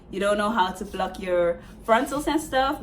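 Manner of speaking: ordinary delivery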